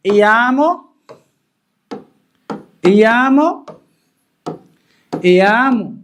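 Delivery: repeated classroom dictation of إيامو; drilled ending. Shown as a demonstration. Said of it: "teacher repeats ending drawn out".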